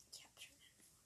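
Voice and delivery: soft whisper. Real, not acted. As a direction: whisper it like a secret. Whispered teaser before question.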